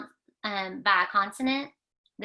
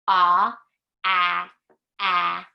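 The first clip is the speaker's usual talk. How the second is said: loud short vowel chant, much louder; alien talk; 2 semitones lower, 8 dB louder, much slower than usual. Pretend. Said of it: "Here we go".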